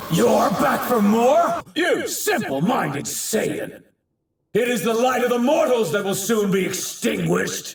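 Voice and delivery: high, indignant tirade; outraged shouting. Acted. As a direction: rage at the foe who keeps returning. Villain enraged by returning foe.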